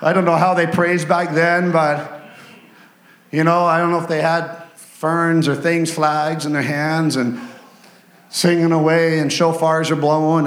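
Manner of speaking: normal talking voice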